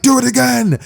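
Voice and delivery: high-pitched shouted plea; urgent demand. Acted. Class all calling for Deku to sneeze, urgent. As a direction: cry out for another try.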